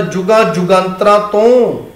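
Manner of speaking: ordinary delivery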